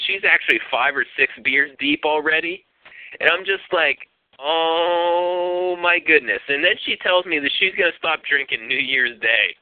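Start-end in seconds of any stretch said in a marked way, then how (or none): 4.4-6.4 long drawn-out, stunned reaction; slow, dismayed retold sigh of words. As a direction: stretch the first word right out. Retold dismay at her drinking.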